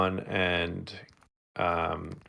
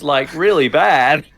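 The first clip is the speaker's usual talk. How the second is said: mock alien voice; 7 semitones higher, 11 dB louder, slower than usual. Alien voice, laughing it off.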